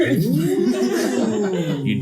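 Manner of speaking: slow, laughing through the offer; laughing villain. Acted. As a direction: chuckle while tempting. God voice laughing mid-offer.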